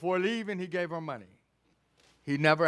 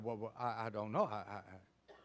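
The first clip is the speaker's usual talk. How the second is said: flustered stammer; 9 semitones lower, 13 dB quieter than usual. Retold or imitated. Mother caught out, stammering.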